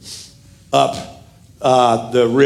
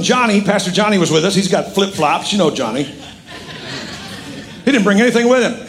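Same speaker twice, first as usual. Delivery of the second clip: usual speaking voice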